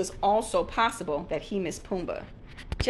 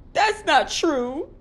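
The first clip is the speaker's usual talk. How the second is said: tearful protest; 8 semitones higher, 6 dB louder, much slower than usual. Acted. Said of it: "Pumbaa protested, tears welling up".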